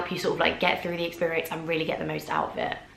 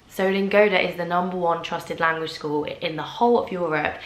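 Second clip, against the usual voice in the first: normal talking voice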